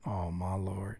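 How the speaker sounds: breathy under-the-breath oh my Lord; soft exasperated aside; whispered dismay. Real, not acted. Reacting to the long submission note.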